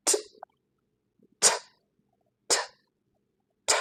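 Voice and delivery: "ঠ" as a tiny voiceless aspirated tick; isolated T sound. Shown as a demonstration. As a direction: release each "ঠ" with a puff, no voice. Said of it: "এর sound হলো". (স্বর ছাড়া ছোট "ঠ" ধ্বনি)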